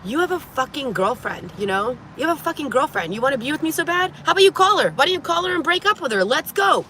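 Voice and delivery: shrill heated confrontation; furious challenge. Retold or imitated. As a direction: dare him to call his girlfriend. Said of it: "then I go".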